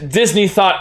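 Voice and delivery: usual speaking voice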